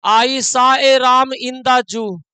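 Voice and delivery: ram said as Hindi "raam", slow dictation; deliberate misreading. Shown as a demonstration. Teacher dictating a trick sentence, slow with "raam". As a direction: read ram as "raam", word by word. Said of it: "हम तो जानबूझ के पढ़ रहे थे राम".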